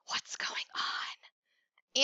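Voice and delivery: slow breathy whisper with no voice. A narrator's reading. Paul whispering across the kitchen to Andy. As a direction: keep it secret from the caller. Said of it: "Paul whispered".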